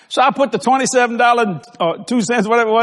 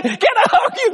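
put-on high, loud, teary-happy girl's voice with laughter; laughing mock girl asking for a hug; imitated waitress; 3 dB louder than usual. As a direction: play the grateful waitress, overcome. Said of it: "She looked at Kathy".

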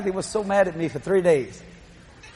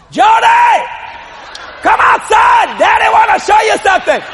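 much higher, loud call across the yard; 13 semitones higher, 6 dB louder, slower than usual. Retold or imitated. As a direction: call your little girl from outside. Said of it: "I said".

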